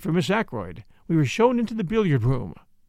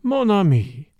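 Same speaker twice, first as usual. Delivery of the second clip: louder, higher appeal to a friend; apologetic; 4 semitones higher, 5 dB louder, slower than usual. A narrator's reading. Poirot soothing the doctor, apologetic. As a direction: placate a reproachful friend. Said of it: "said Poirot apologetically".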